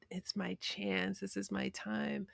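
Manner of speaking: soft, slow, almost whispered wishing; hushed hope. Retold or imitated. Child poring over her dictionary each weekend, hushed hope. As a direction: murmur it like a private dream.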